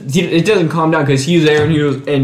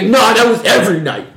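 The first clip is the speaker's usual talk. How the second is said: higher, sharp mock insistence; retold pissed retort; 8 semitones higher than usual. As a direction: snap back at her in imitation. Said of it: "And gets, like, pissed".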